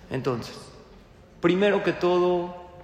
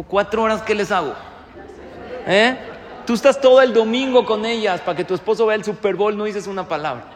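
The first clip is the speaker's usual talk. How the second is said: louder, higher exasperated scolding; mock scolding wife; 4 semitones higher, 5 dB louder, faster than usual. Wife scolding husband who wants out of babysitting, louder and higher. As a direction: scold him like a fed-up wife. Wife's voiced tirade in story.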